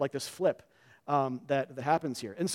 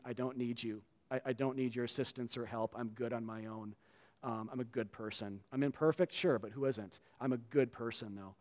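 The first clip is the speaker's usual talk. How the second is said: much softer, a hint of smug self-justification; quiet proud self-talk; 5 semitones lower, 10 dB quieter than usual. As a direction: murmur it like a proud man reassuring himself. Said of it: "we've struck his cheek saying".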